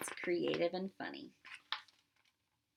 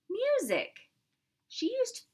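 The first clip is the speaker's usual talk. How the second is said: high, loud reveal of the word; spring the surprise; excited reveal; 13 semitones higher, 4 dB louder than usual. Real, not acted. Reader revealing what Maxine made, high and excited. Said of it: "reveal of vegetable music".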